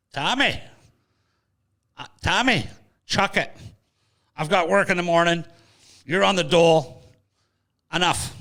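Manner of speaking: retold angry shout of a tired working mother; exasperated mother yelling. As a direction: tell him off like a fed-up wife at midnight.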